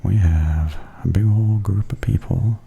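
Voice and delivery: ordinary talk, nothing marked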